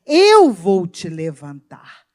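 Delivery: loud, slow, low promise; booming promise. Acted. Preacher voicing God's promise to the congregation, slow booming. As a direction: lift them with a slow booming vow.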